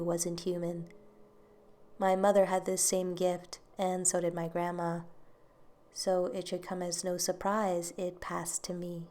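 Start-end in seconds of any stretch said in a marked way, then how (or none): none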